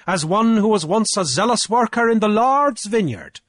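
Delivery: high, loud declaiming challenge; Cornelius, triumphant orator. A narrator's reading. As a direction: orate forcefully to a whole assembly. Cornelius argues before council.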